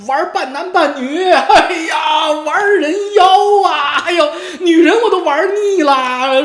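high, loud, laughing camp falsetto; camp mock voice. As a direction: ham up a flamboyant voice, laughing. Mocking a camp shemale persona.

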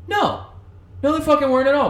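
high, loud protest; loud indignant no. Retold or imitated. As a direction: shout it down, outraged. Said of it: "And I was like".

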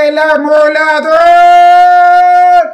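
long, drawn-out high cry of a knife grinder; hollering "amolador" down the street, stretched slow; street vendor call. Retold or imitated. Man retelling the knife grinder calling out in the street, drawn-out high cry.

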